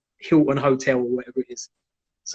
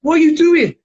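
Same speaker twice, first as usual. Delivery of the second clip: high, loud startled question; imitating shocked disbelief; freaked out; 10 semitones higher, 5 dB louder than usual. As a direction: blurt it as a freaked-out bystander. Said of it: "gets freaked out and goes".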